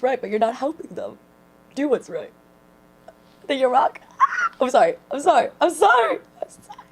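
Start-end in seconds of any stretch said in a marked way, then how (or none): 4.2-4.5 sudden high-pitched shriek of delight; excited shriek. Real, not acted. Streamer reacting to the sermon, high excited shriek. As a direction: squeal at the preacher's point.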